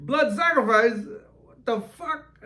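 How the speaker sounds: high, loud shocked squeal; shocked disbelief. Retold or imitated. Shocked reaction to spirit.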